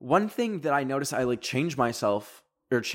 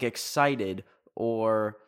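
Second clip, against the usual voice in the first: usual speaking voice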